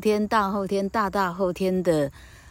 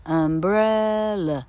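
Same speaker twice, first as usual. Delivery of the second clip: "umbrella" with a long, raised "bre"; stress on BRE; much slower than usual. Shown as a demonstration. Teacher drilling the word for students, exaggerated stress on BRE. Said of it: "你要知道umbrella".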